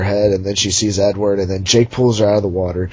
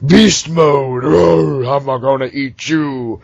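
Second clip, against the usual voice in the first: hammed-up wolf; 4 semitones higher, much slower than usual. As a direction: ham it up like a cartoon monster. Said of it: "he's like".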